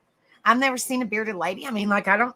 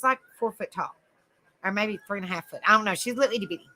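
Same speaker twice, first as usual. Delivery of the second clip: ordinary talk, nothing marked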